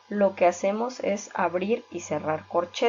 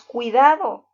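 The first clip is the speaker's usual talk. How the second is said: a sharp, louder "Cuidado"; a teacher's louder demo shout of warning; forceful warning; 3 semitones higher, 7 dB louder than usual. Shown as a demonstration. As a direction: warn them with force. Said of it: "con más fuerza".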